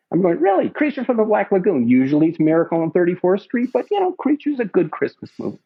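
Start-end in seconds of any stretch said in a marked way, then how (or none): 0.4-1.9 high mock disbelief on Really; retold surprise. Storyteller surprised by director's movie pick, high disbelief.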